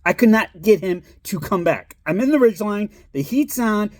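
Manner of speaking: ordinary talk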